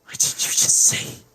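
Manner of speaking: sobbing, self-hating, whispering; tearful anguished outburst; crying. Acted. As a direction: break down crying and curse yourself. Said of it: "she starts crying".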